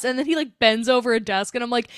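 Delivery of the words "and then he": normal talking voice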